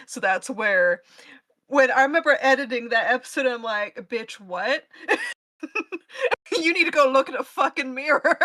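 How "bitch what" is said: ordinary talk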